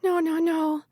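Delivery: high-pitched, drawn wail of dread; distressed moan. A narrator's reading. Callie sees the mayor coming with a gun, moaning. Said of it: "Callie moaned".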